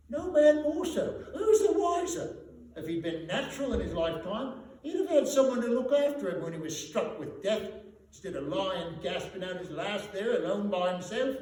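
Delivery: put-on high, loud spiteful old woman; laundress. Laundress mocking the dead man, high loud spiteful voice. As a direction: sneer it with gleeful contempt.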